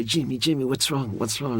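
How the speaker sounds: high, coaxing voice calling to a sulking actor; lightly mimicking the assistant director soothing Jimmy; pleading friend. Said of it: "he'd say".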